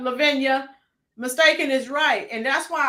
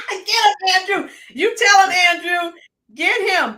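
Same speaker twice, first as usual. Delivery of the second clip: loud, higher hype yell; cheering him on; 6 semitones higher, 6 dB louder than usual. Real, not acted. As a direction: egg Andrew on, laughing and shouting. Egging on a friend in chat.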